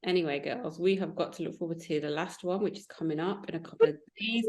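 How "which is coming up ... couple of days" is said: ordinary talk, nothing marked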